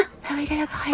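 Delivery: barely audible murmur; tiny, hushed voice; very weak voice. Acted. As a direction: speak far too softly for a friend to hear. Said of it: "Sobrang ina ng boses mo".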